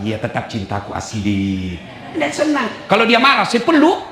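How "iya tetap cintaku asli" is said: low, soft, drawn-out reassurance; sweet-talking husband. Acted. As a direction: coax her slowly, low and soft. Husband coaxes wife about fake gold. (suami merayu dengan lembut)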